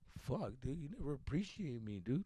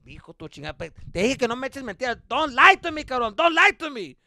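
very loud, higher angry father shouting; furious dad; 11 semitones higher, 16 dB louder than usual. Retold or imitated. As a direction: bellow don't lie at the son. Dad yelling in story.

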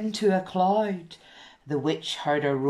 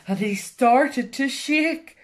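usual speaking voice

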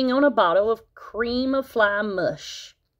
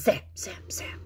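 whispered zap; 7 dB quieter than usual. A narrator's reading. Catching flies.

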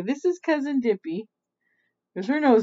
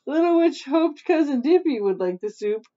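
ordinary delivery

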